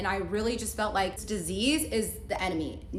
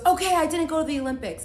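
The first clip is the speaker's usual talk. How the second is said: blurted out high and loud, caught in the lie; sudden admission; 9 semitones higher, 7 dB louder, faster than usual. Retold or imitated. Boyfriend caught lying blurts out the truth, loud and high. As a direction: burst out with it like a cornered liar.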